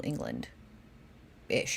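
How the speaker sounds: low, slow whispered aside. Real, not acted. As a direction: drop to a whisper.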